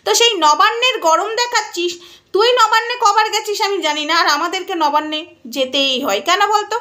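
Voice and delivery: ordinary talk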